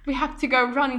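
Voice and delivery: mocking politician in British accent; Boris Johnson British accent. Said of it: "I like your British accent".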